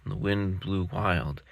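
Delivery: ordinary delivery, nothing marked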